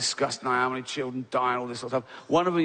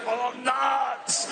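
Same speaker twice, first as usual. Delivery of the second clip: much higher strangled cry; choking lady's gasp; 10 semitones higher than usual. Retold or imitated. The dying passenger blaming the nuts, high and strangled. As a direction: gasp it out as you collapse. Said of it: "she goes".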